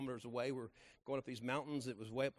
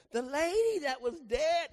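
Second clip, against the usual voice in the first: high, slow and loud, amazed; thrilled high cry of the news; excited reveal; 13 semitones higher, 11 dB louder, slower than usual. Retold or imitated. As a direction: announce it like news you can't believe. Driver reveals the revived woman.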